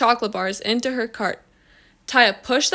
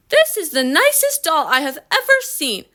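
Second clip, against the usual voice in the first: high, gushing amazement; delighted admiration; 6 semitones higher than usual. A narrator's reading. Lady admiring what she thinks is a doll, delighted. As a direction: gush over it in a raised pitch.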